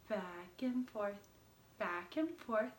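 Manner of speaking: usual speaking voice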